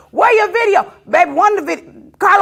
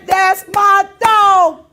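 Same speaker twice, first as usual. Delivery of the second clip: louder, very slow, stretched out; loud proud hype; 5 semitones higher, 5 dB louder, much slower than usual. Real, not acted. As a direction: drag it out proudly. Hyping up his buddy Brett.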